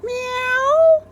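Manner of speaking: kitty meow; cat meow. Acted. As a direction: mew like a cat. Big cat meows.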